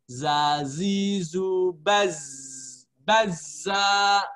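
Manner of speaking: "Zazizu baz." stretched very slowly, each syllable held; slow drilled syllables. Shown as a demonstration. Teacher drills the syllables slowly for students. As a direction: chant it slowly for the class. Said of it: "Ada lagi jim".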